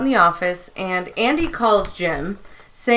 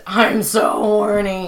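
slow Andy imitation; slower than usual. Imitating Andy's line.

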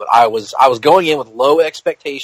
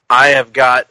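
usual speaking voice

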